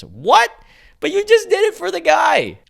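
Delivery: high squeaky what, you just did it; indignant disbelief. Real, not acted. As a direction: protest the double standard, incredulous. AI refuses dumb girl image.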